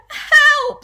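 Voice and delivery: very high, loud shriek for help; panicked scream. Acted. Wife finds something slimy in bed, panicked scream. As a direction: scream it at full pitch in terror. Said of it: "screamed Missus Twit".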